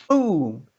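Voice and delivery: sudden loud boom. Retold or imitated. Telling of hitting the man, a sudden boom. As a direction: blurt the crash out loud.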